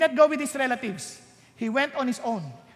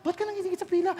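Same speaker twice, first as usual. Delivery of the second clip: put-on child asking; 5 semitones higher than usual. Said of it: "Sabi ng an-, anak".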